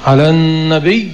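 النبي with a doubled, held final y; pronounced with shadda. Shown as a demonstration. Teacher repeats the verse ending, shadda clearly held. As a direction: lengthen and press the last y of النبي. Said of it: "مع لفظ الشدة".

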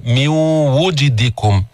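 slow "من وجدكم" with nasal glide into "wu"; "من وجدكم" drawn out, waw said wu; rounded u on waw. Shown as a demonstration. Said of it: "الواو مضمومة".

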